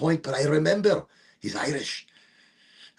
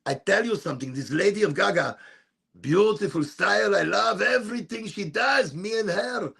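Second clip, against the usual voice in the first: higher, excited mother on the phone; imitating mom; 5 semitones higher than usual. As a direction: play the excited mom calling her son. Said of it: "She says".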